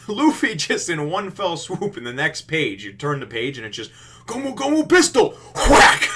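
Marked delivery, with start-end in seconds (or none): 4.3-5.4 high, loud shout of a special move; mock attack cry. Retelling a manga fight to listeners, mock battle shout. 5.5-6.1 explosive shouted whack; sudden loud sound effect for a punch; imitated impact. Retelling Luffy's punch to listeners, explosive impact noise.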